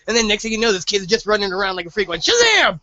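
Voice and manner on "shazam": a big high shout, flung out; shouting the magic word. Retold or imitated. Kid yelling the magic word to summon lightning, full shout. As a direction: yell it like a kid calling down lightning.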